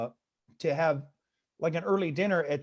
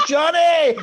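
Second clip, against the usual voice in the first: very high, booming teasing holler; mock name-shout; 11 semitones higher, 12 dB louder than usual. Comic imitates barista hollering his name, mock yell. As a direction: whoop the name like an overexcited barista.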